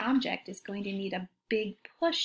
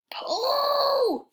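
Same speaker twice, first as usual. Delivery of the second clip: high pretend shout of effort; shout pull; 3 dB louder than usual. Reader urging listeners to pull the cart, high shout. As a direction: yell it like hauling a heavy rope.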